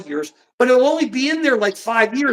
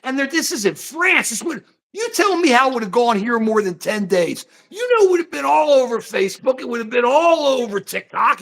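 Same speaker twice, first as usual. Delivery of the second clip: normal talking voice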